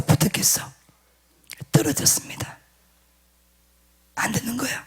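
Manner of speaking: hushed whisper for the reveal; whispered suspense. Acted. Preacher whispering the outcome to the congregation, hushed suspense. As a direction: drop to a whisper, build suspense.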